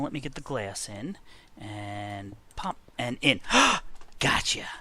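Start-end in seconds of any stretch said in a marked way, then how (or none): 3.4-4.1 high, loud surprised gasp; sharp gasp. Real, not acted. Catching the pet. 4.2-4.7 loud triumphant whispered gotcha; excited whisper. Real, not acted. Catching the pet.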